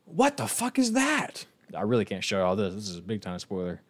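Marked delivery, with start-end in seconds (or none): 0.0-1.5 much higher, slow stunned outburst; shocked. Real, not acted. Streamer reacting to a weird Pokemon design, stunned shout. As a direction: gasp it out in disbelief.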